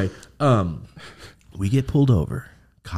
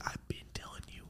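voiceless whisper, much softer; whispered told-you-so; 25 dB quieter than usual. Retold or imitated. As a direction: hiss it under your breath to him. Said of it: "But I was like".

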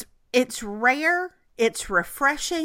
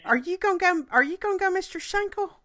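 much higher, fast, playful teasing; 9 semitones higher, faster than usual. Real, not acted. Friend teasing cohost about calling Perry Mister Schenkel, high and playful. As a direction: egg her on with a grin.

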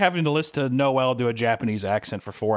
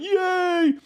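high happy little yay; hammed-up cheer, much higher; mock cheer. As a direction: squeal it like a naive kid. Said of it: "he's like".

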